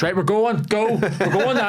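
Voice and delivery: urgent boarding call. Retold or imitated. Airline staff suddenly rushing passengers to board.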